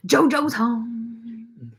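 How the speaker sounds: slow, drawn-out sing-song to the dog; sing-song greeting. Real, not acted. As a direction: stretch it out for the dog.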